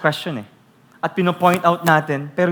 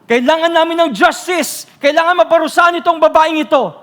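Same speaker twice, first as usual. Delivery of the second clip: much higher, loud, indignant mock voice; mock Pharisees demanding justice; 12 semitones higher, 9 dB louder than usual. Pharisees demanding the woman be punished, high mock outrage. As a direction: cry out for justice like a hypocrite. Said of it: "Sabi nila".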